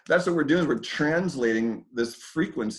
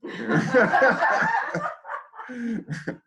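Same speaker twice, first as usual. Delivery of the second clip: big laugh; 13 semitones higher than usual. Real, not acted. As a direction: burst of high laughter. Laughs at the partner's remark.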